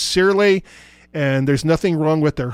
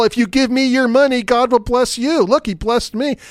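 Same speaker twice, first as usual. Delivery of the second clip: higher, mock preacher pitch; 7 semitones higher than usual. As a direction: parody a greedy televangelist. Mocking preachers asking for money.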